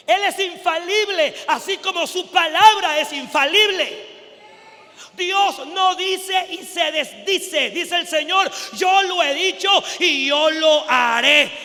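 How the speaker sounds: loud, high, emphatic sermon delivery; full-force preaching. Acted. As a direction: thunder God's promise to the congregation. (prédica a toda voz)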